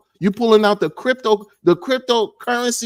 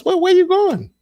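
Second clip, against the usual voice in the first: much higher, lightly voiced nosy stranger; mock high curious voice; 12 semitones higher than usual. Stranger at the bus stop, high mock nosy question. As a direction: pitch it up, hammed-up curiosity.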